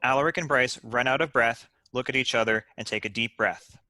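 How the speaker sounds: ordinary delivery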